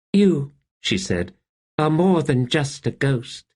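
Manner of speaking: put-on high, wondering girl's voice; a small girl in awe of the ghost; child Kettle. A narrator's reading. Kettle to the wraith, high and wondering.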